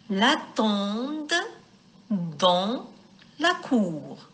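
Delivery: slow, word-by-word dictation style; slow reading aloud. Shown as a demonstration. Teacher reading the text to pupils, slow and broken up. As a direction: read in small groups with long pauses. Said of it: "Je vous lis le texte".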